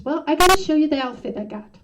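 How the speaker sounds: high, loud and fast, suddenly bubbly; excited mom. Retold or imitated. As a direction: burst out brightly to break the ice, rushing the words. Mom breaks the ice, suddenly upbeat.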